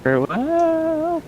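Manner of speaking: high, slow, drawn-out farewell; dramatic farewell. Acted. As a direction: stretch it out, way up high. Dramatic farewell while game plays.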